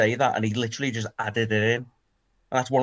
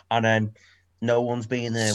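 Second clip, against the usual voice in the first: ordinary talk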